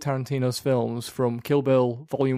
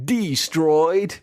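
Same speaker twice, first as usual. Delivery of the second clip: exaggerated film accent, higher and drawn out; hammed-up imitation of an actor's accent; Brad Pitt accent; 4 semitones higher, 4 dB louder than usual. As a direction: mimic his thick accent with gusto. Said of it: "we mentioned Pitt's accent".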